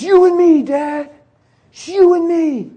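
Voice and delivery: very high, loud wail; high-pitched, drawn-out yell; son freaking out. Retold or imitated. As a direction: imitate a distraught boy crying out at his dad. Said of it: "He's, like, freaking out".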